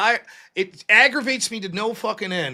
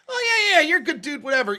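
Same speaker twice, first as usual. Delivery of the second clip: mock flattery; 6 semitones higher than usual.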